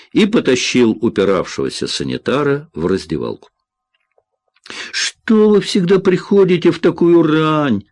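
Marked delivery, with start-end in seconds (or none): 4.9-7.8 high-pitched whiny complaint; plaintive, raised-pitch moaning; whining. A narrator's reading. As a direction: whine it like a put-upon assistant. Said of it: "заныл Винни".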